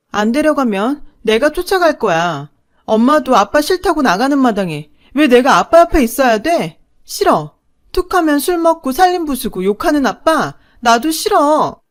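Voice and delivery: loud, high tearful defiance; defiant shouting. Acted. Daughter refuses to stay with father, loud defiant shouting. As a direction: throw it back at her at full voice.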